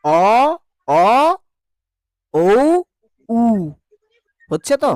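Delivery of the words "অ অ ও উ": অ, অ, ও, উ said with pauses, lips tightly rounded; rounded vowel demo. Shown as a demonstration. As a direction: model each rounded vowel for the mirror. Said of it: "আয়নাতে দেখো".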